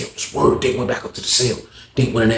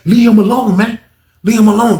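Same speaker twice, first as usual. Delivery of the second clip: higher and louder, leave him alone; raised warning; 9 semitones higher, 6 dB louder than usual. Retold or imitated. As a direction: shout the warning, firm and high. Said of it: "He said".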